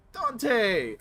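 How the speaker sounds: loud, high hail; calling out. Acted. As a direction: call the name out across the room. Coffee seller calls out name.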